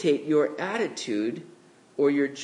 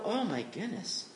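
mock despair; 6 dB quieter than usual. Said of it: "We would look at that and go".

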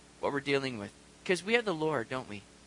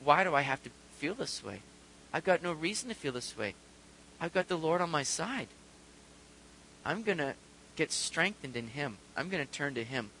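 soft, low resolve; hushed, settled determination; quiet self-talk; 3 semitones lower, 7 dB quieter than usual. Acted. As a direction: reason gently with yourself, voice lowered. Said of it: "look to the Lord and say".

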